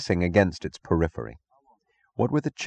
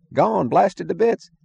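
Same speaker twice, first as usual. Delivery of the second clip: barker voice; 12 semitones higher, slower than usual. Acted. Barker breaks grim news.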